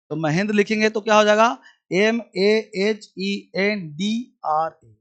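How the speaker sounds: normal talking voice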